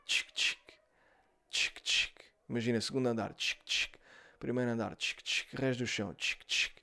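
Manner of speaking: tchic tchic sussurrado do spray; spray imitado. Retold or imitated. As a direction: imita o borrifar do ambientador baixinho. (imitação sussurrada de spray)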